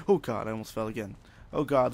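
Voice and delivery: ordinary delivery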